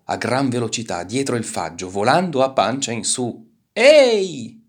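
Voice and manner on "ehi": very high, very loud hail; shout. A narrator's reading. Turtle flying belly-up calling out, high shout. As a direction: cry out from the air. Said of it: "gridò".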